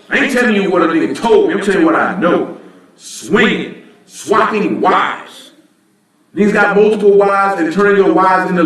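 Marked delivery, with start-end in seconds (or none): 3.0-5.5 drawn out in disgust. Real, not acted. Stressing disgusting practice.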